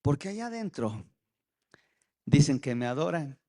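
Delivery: lamento lento y bajo, cada vez más apagado; voz de Dios dolida. Retold or imitated. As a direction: cuenta su dolor en voz baja y quebrada. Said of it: "Al otro que estaba sentado". (Dios rechazado, lamento triste y bajo)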